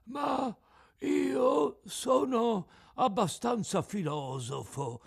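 high, cracked, self-important fish voice; philosophical tuna. Acted. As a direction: philosophize slowly as a tuna consoling itself.

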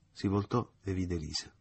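ordinary delivery, nothing marked

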